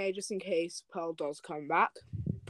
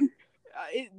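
ordinary delivery

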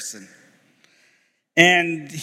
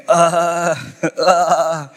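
long, drawn-out stalling sound; stumped stammer. Retold or imitated. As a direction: play a student lost for an answer. Stumped student stalling before professor.

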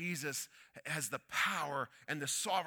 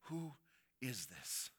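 low, soft, wondering question; awed murmur; 3 semitones lower, 9 dB quieter than usual. Acted. Disciples whisper to each other about Jesus in awe. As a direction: ask it under your breath in awe. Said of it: "they're saying to themselves".

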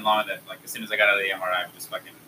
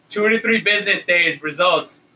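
louder, higher, emphatic mock staff voice; raised-voice repeat; 7 semitones higher, 5 dB louder than usual. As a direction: repeat it loudly for someone in earplugs. Staff repeats it after "Excuse me?.

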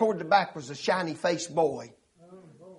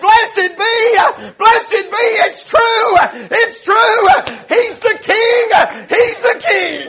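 high, full-voiced revival shouting; jubilant shout; 10 dB louder, much slower than usual. Preacher acts out newly converted Daddy praising, jubilant shout. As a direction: shout each short phrase in praise. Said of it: "began to shout and clap his hands".

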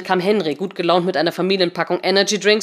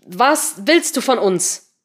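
slow, raised, word-by-word question; emphatic demand; 4 semitones higher, 4 dB louder, much slower than usual. Acted. Patricia typing to the intruder, slow emphatic demand. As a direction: lean on each word, especially "willst".

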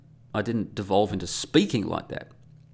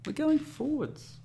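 much higher, slower mock corporate chant; mock manager voice; slower than usual. Vlogger parodying managers, high mock voice. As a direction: send up a manager's mantra.

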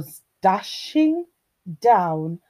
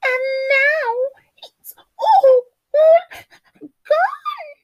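very high, sobbing cub wail; crying Baby Bear; 7 dB louder, slower than usual. A narrator's reading. Baby Bear's porridge is all gone, high tearful wail. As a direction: wail it loud like a tiny bear. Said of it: "cried Baby Bear".

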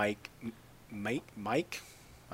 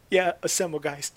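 mock tearful; 6 semitones higher, 4 dB louder than usual. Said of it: "Tears were welling up".

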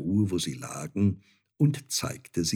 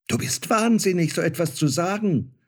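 high, louder mock-furious outburst; angry shout; 8 semitones higher, 3 dB louder than usual. Star child rejecting his mother, shouting angrily. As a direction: snap at her in outrage. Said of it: "schrie das Sternenkind zornig".

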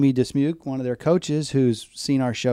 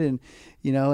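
normal talking voice

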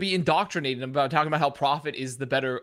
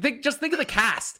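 normal talking voice

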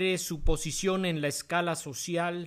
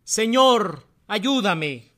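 loud, higher-pitched plea; pleading; 4 semitones higher, 7 dB louder, much slower than usual. A narrator's reading. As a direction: cry out beseechingly for help. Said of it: "le suplicó".

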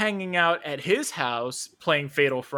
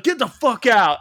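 louder retold yell, a bit higher; mock angry shout; 7 semitones higher, 8 dB louder than usual. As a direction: throw him out, hammed up. Stepdad yelling at stranger.

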